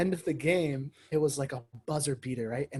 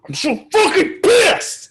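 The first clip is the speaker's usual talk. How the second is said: loud, high furious yell; angry yell; 12 dB louder, much slower than usual. Retold or imitated. Rugby friend yelling over his team's loss. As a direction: yell it like a raging rugby fan. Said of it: "rugby friends ... would be like".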